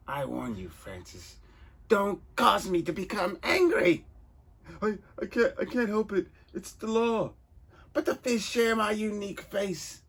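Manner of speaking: high, loud theatrical villain; pompous villain voice. Acted. Reader voicing the villain, loud and high. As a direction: ham up a vain comic-book crook.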